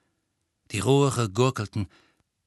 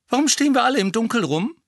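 put-on higher, louder, fast young woman; woman's voice; 12 semitones higher, 6 dB louder, faster than usual. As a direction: toss it off quickly like an impatient young woman. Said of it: "die einer Frau".

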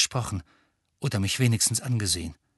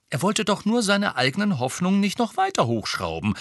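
higher, lecturing know-it-all; 9 semitones higher than usual. Acted. Girl explaining the godfather's feelings to her friends, know-it-all. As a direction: explain it like she knows best. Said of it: "sagte Hermine altklug".

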